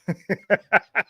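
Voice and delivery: quick, soft run of laughs; teasing laughter. Real, not acted. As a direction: laugh at your own jab. Laughs after teasing remark.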